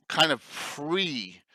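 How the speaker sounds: ordinary delivery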